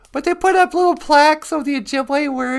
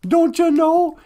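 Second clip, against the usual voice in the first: Don't you know with a broad Minnesota lilt; Minnesota accent; 3 semitones higher than usual. Shown as a demonstration. Host showing callers how Minnesotans say it, Minnesota accent. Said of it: "That's what we say in Minnesota".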